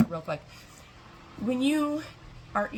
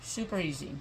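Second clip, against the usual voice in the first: talking with a mouthful; chewing while speaking; mouth full; 10 dB quieter than usual. Real, not acted. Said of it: "Sorry for talking with my mouth full".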